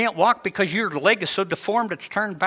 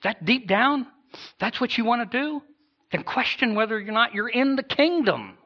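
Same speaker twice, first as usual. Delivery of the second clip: incredulous challenge; 6 semitones higher than usual. Retold or imitated. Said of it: "Scriptures are gonna say".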